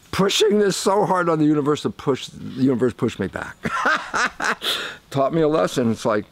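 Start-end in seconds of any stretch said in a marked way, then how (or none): 3.6-5.1 breathy high laugh; airy amused chuckle. Real, not acted. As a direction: laugh at yourself. Laughs at his lesson.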